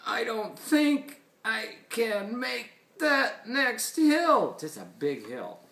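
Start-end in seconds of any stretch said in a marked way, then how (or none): 0.1-4.6 high, slow, labored character voice; story beast doubting he can make it; muttering, struggling Beast. A narrator's reading. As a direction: strain through it slowly, pitched up. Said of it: "then finally muttered".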